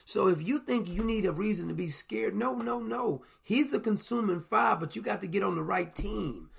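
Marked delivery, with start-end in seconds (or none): none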